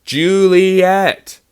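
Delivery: loud, high, stretched-out call; every syllable belted, falling at the end; calling out full name. Shown as a demonstration. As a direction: yell "Juliet" in full range like a parent. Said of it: "in its full range".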